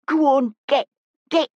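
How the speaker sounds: mild shout to send someone packing; shooing them off. Acted. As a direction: chase the thugs away. Woman shouts thugs away.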